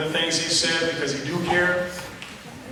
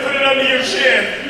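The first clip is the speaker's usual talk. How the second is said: loud playful instruction; 8 semitones higher, 6 dB louder than usual. Retold or imitated. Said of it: "And then I said".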